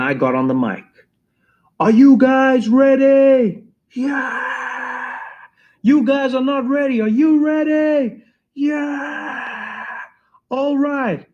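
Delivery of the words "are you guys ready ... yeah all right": hype man shout. Retold or imitated. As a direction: work the crowd from the stage. Hyping crowd before the dance.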